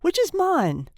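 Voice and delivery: high-pitched, anxious asking; gasped question. A narrator's reading. Father searching for his newborn, gasping high. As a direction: ask it as a gasp. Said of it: "gasped Mister Button".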